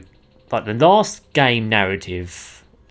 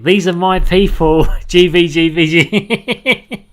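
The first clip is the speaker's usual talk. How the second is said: higher, louder, slowed mock British-colonial accent; mocking exaggerated game accent; 7 semitones higher, 7 dB louder, slower than usual. Streamer mocks the game characters' exaggerated accent. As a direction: drag out a hammed-up olde English game accent. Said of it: "hardcore making fun of their accents".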